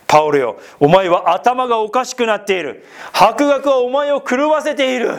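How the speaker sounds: a high, slowed outburst declaring Paul mad; Festus shouting. Acted. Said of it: "フェストゥスが大声で言った".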